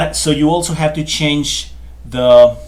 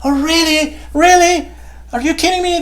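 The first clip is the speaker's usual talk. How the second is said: high, bright mock-amazed "really?"; students' excited disbelief. Teacher imitating happy students hearing the rule, amazed. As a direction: play a thrilled student who can't believe it. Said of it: "like, they say".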